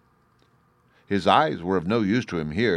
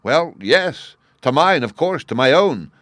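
cheerful shepherd cry; 6 semitones higher, 7 dB louder than usual. A narrator's reading. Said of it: "cried the shepherd".

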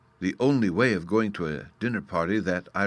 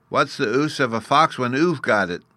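put-on lightly childlike, raised and louder; little fairy boy, retorting; 5 semitones higher, 5 dB louder than usual. Bruno turns the narrator's question back, retorting.

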